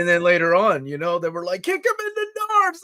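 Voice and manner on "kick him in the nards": high mock urging, held up in pitch; urgent kid. Kids urge friend to kick Wolfman, high urgent mimicry. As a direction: imitate a kid egging on a friend.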